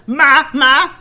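loud, high yell for mother; panicked kid's shout for mum; calling out. Acted. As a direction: holler through the house in alarm. Acted boy calling his mother.

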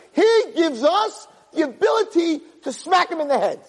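preaching at full force. Acted. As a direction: build the sermon to a fervent peak.

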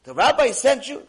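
high, loud question; acted disbelief. Truck driver questioning the patient about the rabbi, incredulous. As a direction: ask it loudly, incredulous. Man astonished at referral.

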